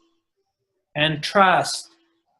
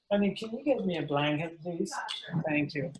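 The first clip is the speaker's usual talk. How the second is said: quiet aside; 13 dB quieter, faster than usual. Real, not acted. Aside to partner off mic.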